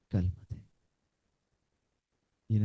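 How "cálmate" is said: low, soft, calm single word; calm command. Acted. Jesus calming the storm, low and soft. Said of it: "con una sola palabra".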